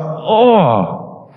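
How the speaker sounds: high drawn-out groan of regret; mock disgust. Dad regretting his outburst, high disgusted groan. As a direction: groan as if feeling gross. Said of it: "I felt absolutely gross".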